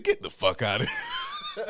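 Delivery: high, drawn-out cry breaking into laughter; amused disbelief. Retold or imitated. Storyteller reliving the Tyler coincidence, laughing in disbelief. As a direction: laugh through the mock-outraged words. Retold amazement at shared name.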